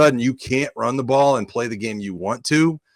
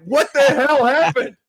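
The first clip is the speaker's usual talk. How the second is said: much higher, slowed baffled fan voice; hammed-up baffled fan, much higher and slow; mock baffled fan; 14 semitones higher, 4 dB louder, slower than usual. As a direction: play a confused fan in the stands, high and drawn out. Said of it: "people are like".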